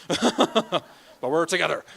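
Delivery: laughing. Real, not acted. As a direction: laugh as you call it out. Joking about the concert theme.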